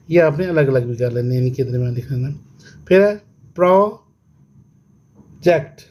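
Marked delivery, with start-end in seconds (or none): 3.6-5.8 slow "project" with a long gap after pro; syllable by syllable. Shown as a demonstration. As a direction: break project into pro and जेक्ट. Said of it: "टू syllable". ("Project" दो हिस्सों में, बीच में रुककर)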